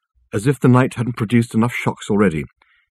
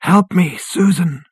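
high, desperate wail for help; pleading cry; 8 semitones higher, slower than usual. A narrator's reading. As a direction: wail it high, begging to be rescued. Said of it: "he cried again".

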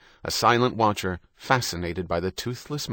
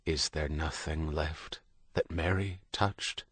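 soft, slow inner voice; hushed and grieving, low; inner whisper; 4 semitones lower, 9 dB quieter than usual. A narrator's reading. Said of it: "He heard the whisper of O'Neill within".

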